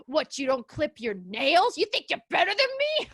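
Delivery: a hammed-up, angry subway man; angry stranger. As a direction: snap at her like a tough guy on the train. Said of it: "And he was like".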